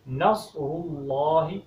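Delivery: نصر said slowly with a heavy, emphatic sad; correct sad. Shown as a demonstration. Teacher shows the correct heavy sad in نصر. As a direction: stretch نصر with a full sad sound. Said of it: "یہ صاد پڑھنا ہے یہاں پر۔".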